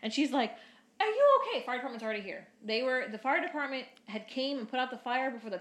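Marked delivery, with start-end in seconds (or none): 1.0-1.6 very high, loud alarmed cry; frantic mom. Retold or imitated. Mom jumping out of rolling car, frantic high cry. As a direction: burst out of the car in a panic.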